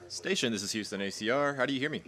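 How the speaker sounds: radio communicator. Real, not acted. Radio voice check over comms.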